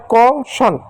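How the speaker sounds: "कौशन" as kau, then shan, falling; silent letter pronunciation. Shown as a demonstration. Teacher repeating the silent-letter example, syllable by syllable. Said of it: "यहाँ देखो ई silent है".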